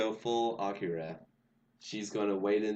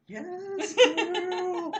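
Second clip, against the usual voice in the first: very high, cheering shriek; cheering yell; 6 dB louder than usual. Retold or imitated. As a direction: shriek it out in delight. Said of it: "Lynn yelling".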